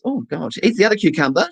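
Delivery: usual speaking voice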